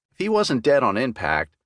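plain reading voice

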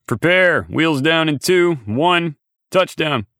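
high, loud pilot's callout; pilot over earpiece. A narrator's reading. Pilot warning the team of landing, loud high announcement. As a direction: call the landing countdown loudly. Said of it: "The pilot's voice came over my earpiece".

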